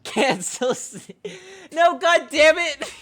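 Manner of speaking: high, loud, slow hammed-up wail; mock outraged yell. Host pretends to despair after being told cancel [name], mock outraged yell. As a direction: ham up the despair as a joke.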